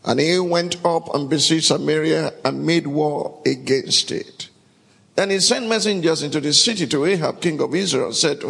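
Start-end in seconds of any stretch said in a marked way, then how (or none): none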